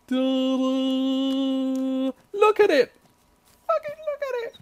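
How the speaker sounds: high, squealing pride; excited showing off. Real, not acted. Gamer squealing proudly as he shows off his cabin.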